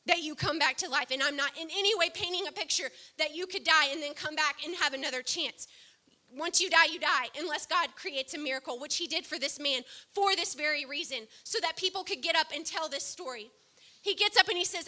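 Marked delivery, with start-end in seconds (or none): none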